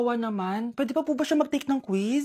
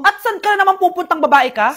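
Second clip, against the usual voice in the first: mock scolding mom; 4 semitones higher, 7 dB louder than usual. Mother scolding daughter leaving.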